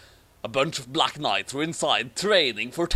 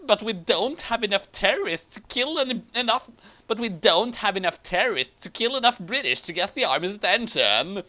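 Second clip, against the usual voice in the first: higher, lightly voiced female soldier; mock female knight; 5 semitones higher than usual. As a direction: play the girl knight a bit high.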